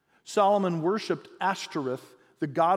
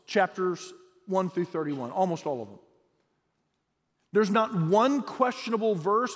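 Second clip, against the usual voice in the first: usual speaking voice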